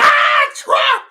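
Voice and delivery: very high, loud panicked shriek; mock triggered scream. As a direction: scream it like someone triggered, mocking. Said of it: "these motherfuckers are triggered by Trump".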